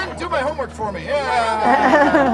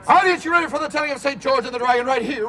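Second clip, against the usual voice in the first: usual speaking voice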